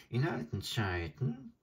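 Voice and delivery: high, croaking bird voice; a scornful old stork sneering; adjutant bird. Acted.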